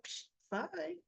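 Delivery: high, quiet scoff; dismissive scoff. Retold or imitated. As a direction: brush her off with a soft high scoff. Christina dismissing the mother.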